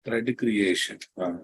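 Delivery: ordinary delivery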